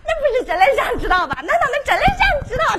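rising teasing Henan-dialect nag; Tang Seng needling in Henan dialect. Acted.

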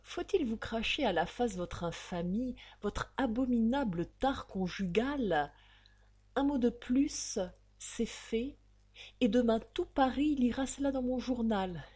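usual speaking voice

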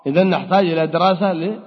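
ordinary delivery

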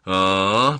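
long, loud drawled "aah"; drawn-out "ah". Acted. Grey-haired man takes in the introduction, drawling. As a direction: stretch out an "ah" of acknowledgement. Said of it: "протянул седовласый".

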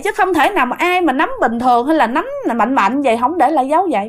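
ordinary delivery, nothing marked

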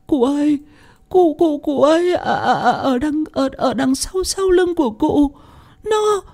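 stutter, pointing at the demon behind him; terrified, tearful. Acted. Crying girl warning her master of the demon. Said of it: "nước mắt nước mũi tèm lem".

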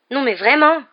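loud, high, indignant interjection; indignant, pitch swooping up; exasperated call. A narrator's reading. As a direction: throw it in from behind them. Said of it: "lança quelqu'un derrière eux".

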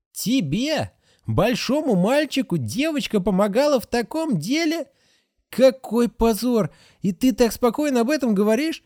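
be shocked and shaming; outraged nurse. A narrator's reading. Nurse Agatha shames the boy, high and scandalised. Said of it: "nurse outraged at boy".

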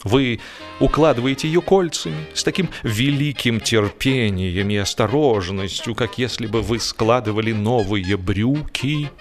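ordinary delivery

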